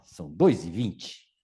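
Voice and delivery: low, slow, matter-of-fact shop clerk. Retold or imitated. Clerk tells the boy the real price, low and slow. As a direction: drop the voice and state the price flatly.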